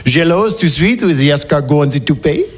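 hammed-up fake French accent; mock French sophisticate, laughing; phony French accent. Shown as a demonstration. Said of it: "Now, apply your phony French accent".

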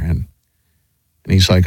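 ordinary talk

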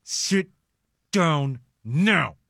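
hush, then each word on its own; controlled scolding, SIT, DOWN, NOW spaced out; angry parent demo. Shown as a demonstration. Dad ordering kids to sit, controlled anger. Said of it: "When I get really angry".

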